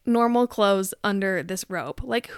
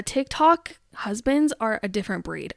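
ordinary talk, nothing marked

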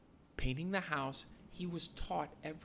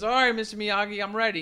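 eager Daniel; 7 semitones higher, 10 dB louder than usual. Retold or imitated. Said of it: "he says".

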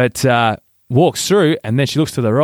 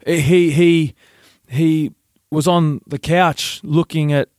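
halting, slow mum, higher-pitched; unable to say it; 4 semitones higher, slower than usual. Retold or imitated. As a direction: stammer towards the embarrassing word and stall. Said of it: "she couldn't say it".

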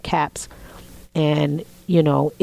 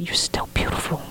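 soft whisper; 6 dB quieter than usual. Retold or imitated. Husband's tender reassurance retold.

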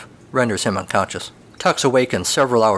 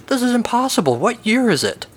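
high, panicky disbelief; cowboy panicking about the year; panicked; 12 semitones higher than usual. Acted. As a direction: voice jumps up in alarm.

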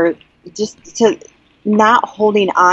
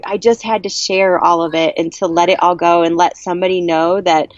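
ordinary talk, nothing marked

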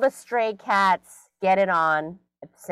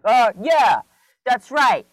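loud, swaggering mock taunt; badass dog; 6 semitones higher, 7 dB louder, slower than usual. Owner voices dog taunting a fleeing cat, cocky shout. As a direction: crow after the fleeing cat, higher and louder. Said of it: "he thinks he's a badass".

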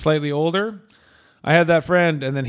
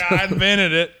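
mock southern accent; 4 semitones higher than usual. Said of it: "why Vern Gagne has a southern accent".

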